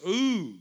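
a dramatic ooh at the idea; mock ooh. Pastor reacting to evil workers with a mock ooh.

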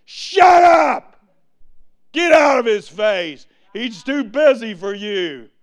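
loud high snap, fading; disciples scolding. Acted. Disciples telling the blind man off, angry shout. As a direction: brush him off harshly. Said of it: "Jesus' disciples said".

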